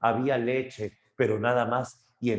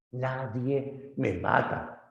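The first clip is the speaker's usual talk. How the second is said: lento, un poco más agudo, voz de cíclope; Polifemo lento; 4 semitones higher, much slower than usual. Acted. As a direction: alarga cada palabra como un gigante herido. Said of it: "Polifemo grita". (Polifemo lento y quejumbroso)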